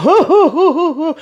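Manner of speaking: high, imitated "hoo hoo" laughter; put-on high-pitched giggling hoots; mock hooting laugh. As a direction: hoot with laughter, mimicking someone tickled. Imitating woman laughing at the outfit.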